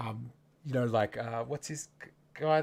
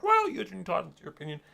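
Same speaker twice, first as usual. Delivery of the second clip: higher, sing-song mock of a smug commenter; 6 semitones higher, 4 dB louder than usual. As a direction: parrot it back with fake politeness. Mocking what commenters say.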